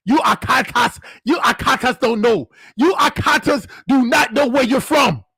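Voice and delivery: mock Nigerian accent, working up to a shout; indignant Nigerian man scolding; mad Nigerian. Playing a mad Nigerian man scolding Akatas in a mock accent. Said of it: "That's the mad Nigerian".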